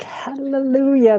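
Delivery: high, drawn-out imitated hallelujah; a lightly mocked churchy hallelujah; churchgoer's hallelujah. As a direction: mimic the congregation's fervent praise. Congregation voiced in retelling.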